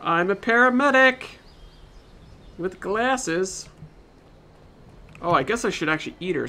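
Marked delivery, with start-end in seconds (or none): 0.0-3.7 excited. Real, not acted. Admires new outfit.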